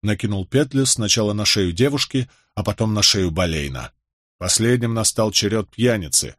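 usual speaking voice

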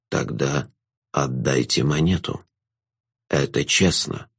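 low, slow, drawn-out jester voice; a knowing villain savouring the moment; low, slow jester passing judgement on the girl; sly jester. A narrator's reading.

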